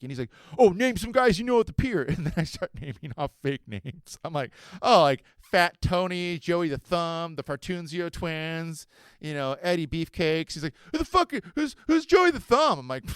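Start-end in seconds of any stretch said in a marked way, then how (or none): 0.6-2.0 mocking kid. Kid challenges him. 4.8-10.5 slow fake names. 10.9-12.8 put-on high, loud outraged kid voice; indignant kid.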